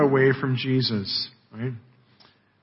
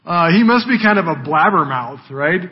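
normal talking voice

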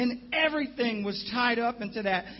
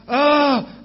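retold groan; 4 semitones higher, 9 dB louder than usual. Fan going crazy.

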